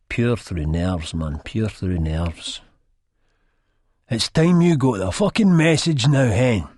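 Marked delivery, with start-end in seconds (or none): 4.1-6.7 higher, louder rough taunt. A narrator's reading. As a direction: throw it at her forcefully. Chizzy acted out, taunting the lassie.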